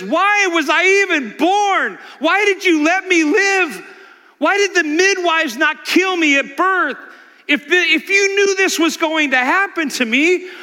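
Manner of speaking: Job crying out. Acted. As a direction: plead with heaven like a broken man. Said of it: "And he cries out to God".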